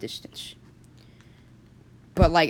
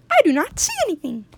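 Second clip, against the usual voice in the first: much higher and fast, squeaking it out; squeaky complaint; 12 semitones higher, faster than usual. Acted. Jonathan squeaks with his face pressed on the tube, high and fast. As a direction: squeak it quickly, face squashed.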